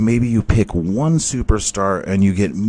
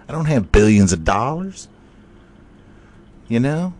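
higher mocking voice; other guy; 7 semitones higher, 3 dB louder than usual. Splat challenging Eugene, in a higher put-on voice. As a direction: needle him in a higher voice.

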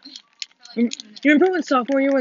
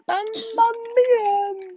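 mock Italian exclamation; 12 semitones higher, much slower than usual.

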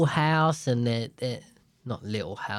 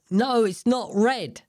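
higher, emphatic mock contradiction; insistent adult; 8 semitones higher, 3 dB louder than usual. Adult overruling a child about the can, mock-insistent. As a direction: overrule the child as a stubborn grown-up. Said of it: "And you go".

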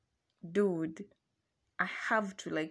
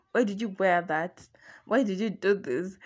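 mock jealous boyfriend; 8 dB louder than usual. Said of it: "he got pissed".

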